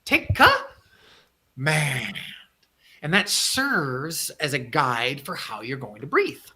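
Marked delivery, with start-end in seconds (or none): 0.1-2.2 "Take command" in two beats, "Take com-" quick, long pause, "-mand" sustained; shifted emphasis. Shown as a demonstration. Coach repeating the breath mnemonic for listeners, split and stretched. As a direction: hold back the end of "command", then let it out long. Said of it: "put the emphasis on the other syllable".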